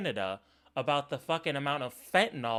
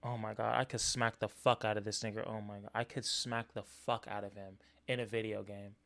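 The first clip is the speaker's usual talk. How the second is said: low exasperated mutter; 9 semitones lower, 8 dB quieter than usual. Real, not acted. Muttering fury at the clip.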